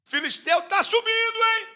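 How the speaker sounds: high, loud alarmed call; panicked warning. Retold or imitated. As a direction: warn him in a rush of fear. Fleeing people warn Samar.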